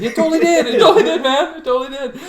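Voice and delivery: high, loud, giggling admission; laughing agreement. Retold or imitated. Patient admitting to the nurse through laughter, high and loud. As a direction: gush it out while cracking up.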